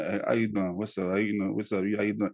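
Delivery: relaxed, off-air hello; casual with friends. Shown as a demonstration. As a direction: toss it off loosely, as to your buddies. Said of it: "when I'm around friends".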